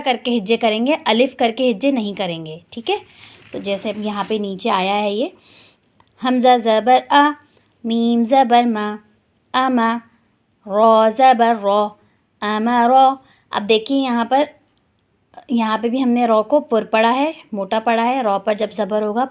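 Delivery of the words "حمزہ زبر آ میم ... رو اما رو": slow, deliberate letter-by-letter recitation; slow spelling drill. Shown as a demonstration. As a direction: drill each syllable slowly for the class. (آہستہ ہجے کی مشق)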